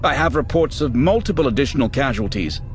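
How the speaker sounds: lightly voiced nervous officer; anxious bridge officer. A narrator's reading. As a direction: report bad news quickly and tensely. Officer reports, held voice.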